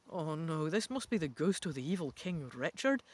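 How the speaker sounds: ordinary delivery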